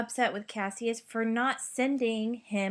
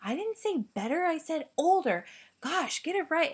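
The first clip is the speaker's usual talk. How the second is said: higher, huffy and indignant; huffy correction; 6 semitones higher than usual. Retold or imitated. The teacher voicing Cassius correcting Brutus, huffy. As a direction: set him straight, huffy. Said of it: "and so they're arguing".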